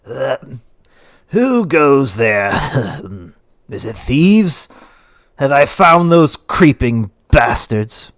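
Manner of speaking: slow, loud challenge to thieves; tipsy town speaker yelling into the street; drunken yell. Acted. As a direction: call out suspiciously, drawing the words out. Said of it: "and yells".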